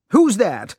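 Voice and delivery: sharp, startled challenge into the dark; angry. Acted. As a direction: snap the question at the hidden child. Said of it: "He spoke angrily".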